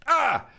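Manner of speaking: high, short yelp of alarm; sudden high startled cry; sharp startled yelp. Real, not acted. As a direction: jolt out a high "ah". Reacts to a pitched sound.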